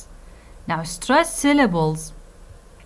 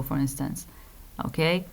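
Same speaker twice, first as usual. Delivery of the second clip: ordinary talk, nothing marked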